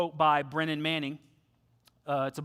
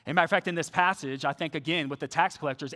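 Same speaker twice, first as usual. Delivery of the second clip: ordinary talk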